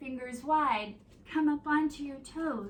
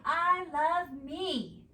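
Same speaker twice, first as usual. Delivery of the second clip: slow, louder, sung-out self-love line; slow affirmation; 4 semitones higher, 6 dB louder, much slower than usual. Shown as a demonstration. Teacher leading kids in a slow self-hug affirmation. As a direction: say it proudly and slowly. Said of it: "and say".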